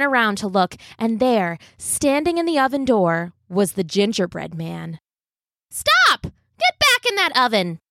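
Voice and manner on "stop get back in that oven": high, loud alarmed cry; shouting. Acted. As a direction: yell it in shock. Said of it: "the old woman cried".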